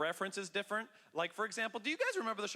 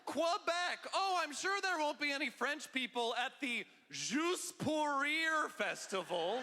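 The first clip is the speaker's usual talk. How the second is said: exasperated shout; 9 semitones higher, slower than usual. Retold or imitated. Comedian yelling at his friend about the Montreal taping. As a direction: blurt it out as if it should be obvious. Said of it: "And I say".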